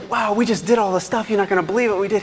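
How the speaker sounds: high, eager rush of excitement; thrilled disciples bragging to their teacher; excited apostles. Retold or imitated. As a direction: gush like students who aced it. Apostles excitedly reporting back.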